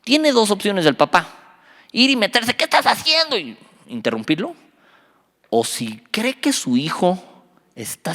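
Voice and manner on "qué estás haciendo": sharp, high-pitched confrontation; outraged father intervening. Acted. Father confronting the man offering his children drugs, outraged. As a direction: jump in with an alarmed challenge.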